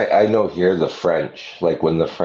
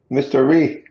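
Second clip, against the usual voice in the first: Mister Ree said with an R for the L, higher; 8 semitones higher than usual. Retold or imitated. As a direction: tease with Ree instead of Lee. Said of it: "they all say Ree".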